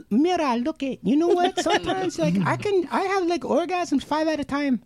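high-pitched mock woman's voice; Romina. Cohost mimicking a woman boasting of multiples, high voice. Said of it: "Romina was like".

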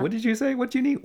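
high-pitched mock questioning; exaggerated high voice for an acted exchange. Acted exchange about Philly water.

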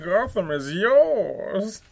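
very loud, slow, muffled through a mug; booming villain voice into a cup; Bane voice. Shown as a demonstration. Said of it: "That was almost too Frankenfurter".

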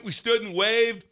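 normal talking voice

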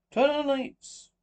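loud, high, drawn-out demand; calling out. Acted. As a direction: call across the dark room for lights. Guest calls out in the dark.

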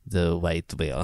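put-on slow, low stab at a Russian accent; halfhearted Russian accent, drawn out; attempted Russian accent. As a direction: try a Russian accent, unsurely. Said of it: "How do you do a Russian accent".

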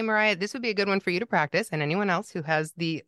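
ordinary talk

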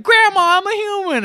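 put-on boy turned back from mouse calls to grandma, high and excited. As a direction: burst out with childish delight. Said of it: "And he's just like".

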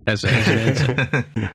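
slow, dry sarcastic aside; deadpan sarcastic. Acted. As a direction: drag it out with mock seriousness. Joking Tilly is most qualified.

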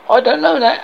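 mock alarm at being caught out; caught-out panic. Bridge keeper caught by question.

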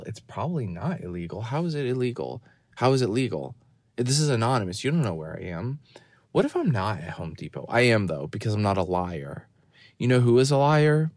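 ordinary talk, nothing marked

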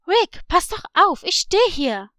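loud, high scolding shout; indignant yell. Acted. Sister yelling at brother splashing into the water, indignant. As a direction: yell at him to watch out. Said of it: "Sie schrie".

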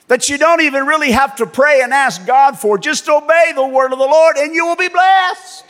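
ordinary talk

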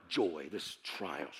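low, slow, soft grumble; grumbling. Retold or imitated. Frustrated man alone with scripture, low grumbling. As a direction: mutter it sourly to yourself. Retells grumbling over the verse.

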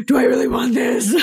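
hammed-up alarm at letting someone in; mock panicked gasp. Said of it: "I was like".

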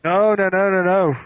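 loud, high run of noes; emphatic denial. Real, not acted. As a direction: object loudly and insistently. Loudly disputing the video limit.

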